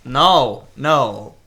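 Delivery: usual speaking voice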